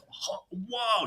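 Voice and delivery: high, hushed whoa of wonder; floored by what he hears; amazed whoa. Retold or imitated. Teenage kid hearing raw blues, high hushed amazement. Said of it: "he's just like".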